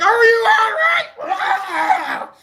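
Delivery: high shouted question then a yell; shouting over music. Retold or imitated. Shouting in the pit.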